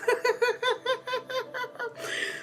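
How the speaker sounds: delighted laugh. Real, not acted. Greeting viewers, "Hey, sugars.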